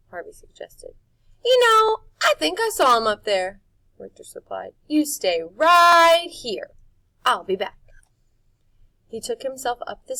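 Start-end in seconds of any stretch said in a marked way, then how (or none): 1.4-3.6 much higher, loud showman's voice; quick sly cover-up; Rictus voice. A narrator's reading. Rictus lies to Harvey about the presents, high and loud. 4.9-7.8 high, loud, slow command; Rictus voice. A narrator's reading. Rictus orders Harvey to stay, high and slow.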